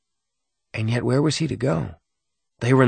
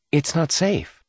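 warning mother; 6 semitones higher, slower than usual. A narrator's reading. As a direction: warn a child, pitched up. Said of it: "His mother had always warned him".

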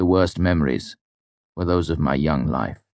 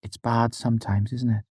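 sad; 6 semitones higher than usual. A narrator's reading. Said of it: "she said sadly".

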